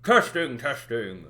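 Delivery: ordinary talk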